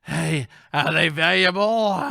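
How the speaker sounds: slow, loud, high impersonation; character voice. Acted. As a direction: draw out the question in a silly voice. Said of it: "That's his voice, all right?".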